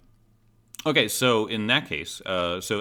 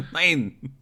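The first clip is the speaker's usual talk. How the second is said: a high, jokey German "Nine" said as nein; mock German nein; 8 semitones higher, 4 dB louder than usual. Echoing the German listener joke, mock German accent. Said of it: "do a German accent".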